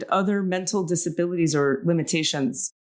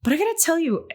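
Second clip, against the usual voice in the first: ordinary talk, nothing marked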